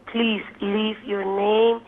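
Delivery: ordinary talk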